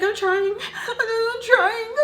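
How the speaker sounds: very high, whimpering mock cry; mock tearful panic. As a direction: whine it as if near tears. Retold freaking out under scolding.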